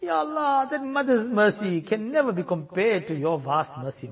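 usual speaking voice

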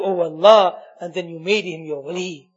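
ordinary talk

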